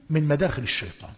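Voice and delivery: ordinary talk, nothing marked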